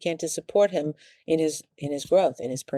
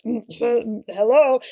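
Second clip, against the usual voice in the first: knowing mm hello; 6 semitones higher, 5 dB louder, slower than usual. Retold or imitated. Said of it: "everyone's like".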